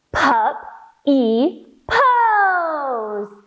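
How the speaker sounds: very slow, sing-song bouncing words; bouncy stretched puppy pose. Shown as a demonstration. Teacher bounces the kids through puppy pose, very slow sing-song. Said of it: "bounce here for puppy pose".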